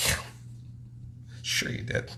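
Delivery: whispered, doubting; sarcastic whisper. Real, not acted. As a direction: skeptical aside under breath. Doubting his motorcycle claim.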